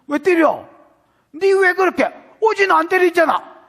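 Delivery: high, loud outraged protest; indignant shout. Acted. Child yelling at the parent who hit him, outraged shout. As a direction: yell back like a wronged kid.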